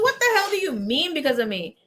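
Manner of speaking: high-pitched retold protest; playful indignant comeback; mock indignation. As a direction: throw it back, incredulous and high. Retold comeback to brother's accusation.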